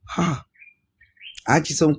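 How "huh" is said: plain reading voice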